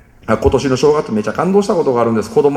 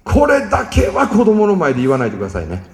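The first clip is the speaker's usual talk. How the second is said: ordinary talk, nothing marked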